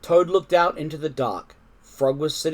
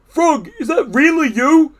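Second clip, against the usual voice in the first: high, loud question; Toad's voice; 12 semitones higher, 9 dB louder than usual. A narrator's reading. Toad wakes to find Frog there, high amazed question. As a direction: ask eagerly in a raised voice.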